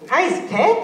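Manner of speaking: high, loud cheer; excited cheer. Acted. Friend cheering Pip after the bear falls, excited. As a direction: shout praise up at a friend.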